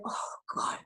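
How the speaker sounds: breathy, near-whispered groan of dread; dreading sigh. Acted. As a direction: sigh it out under your breath. Acted Sonia dreading Juliana's arrival.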